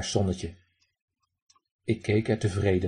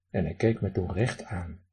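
ordinary delivery, nothing marked

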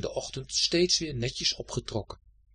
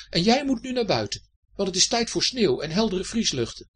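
high, raised-voice order; getting a bit angry; 8 semitones higher, 5 dB louder than usual. A narrator's reading. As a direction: order them out, temper rising. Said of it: "Hij begon een beetje boos te worden". (geïrriteerd bevelend, hoog)

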